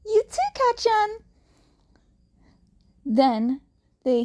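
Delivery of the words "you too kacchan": much higher, brighter comeback; cheery rival reply. A narrator's reading. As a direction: toss it back sweetly but sure. Izuku answering Kacchan's taunt.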